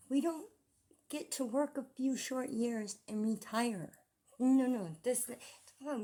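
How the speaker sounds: loud, high indignant rant; angry rant. Real, not acted. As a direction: vent your outrage. Ranting about freeloaders.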